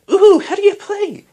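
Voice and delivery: very high, loud excited question; eager child. A narrator's reading. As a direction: squeak it with keen curiosity. Eager child asks about the game.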